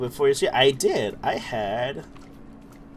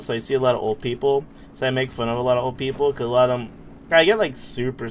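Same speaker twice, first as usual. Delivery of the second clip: normal talking voice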